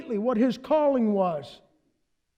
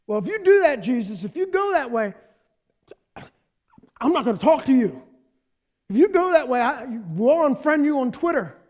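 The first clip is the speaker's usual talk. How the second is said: whiny threat; 4 semitones higher, 4 dB louder than usual. Retold or imitated. Whiny Peter threatens Jesus.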